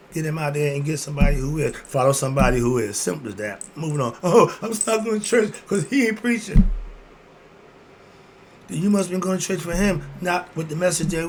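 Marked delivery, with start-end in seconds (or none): 4.2-6.7 high, put-upon churchgoer complaint; a hint of a grumbling churchgoer; mock churchgoer whine. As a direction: mimic a whiny churchgoer, lightly.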